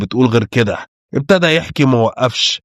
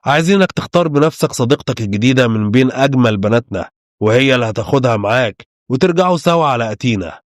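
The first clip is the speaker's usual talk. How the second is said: ordinary delivery, nothing marked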